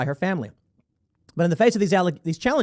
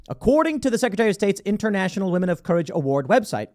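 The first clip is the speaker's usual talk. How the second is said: ordinary talk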